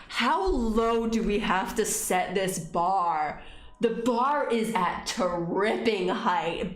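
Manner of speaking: slow, drawn-out rant; slow deliberate rant; slow emphatic. Real, not acted. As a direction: spell it out slowly.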